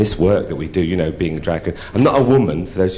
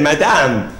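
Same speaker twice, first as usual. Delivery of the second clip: raised French call to a lady; French count summoning her; French accent; 5 semitones higher than usual. Acted. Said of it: "said to me in a French accent".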